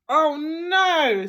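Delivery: high, loud exasperated groan; fed-up Mr Bear. Acted. Mr Bear kept awake by snoring, high exasperated cry. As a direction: wail it, at the end of your tether.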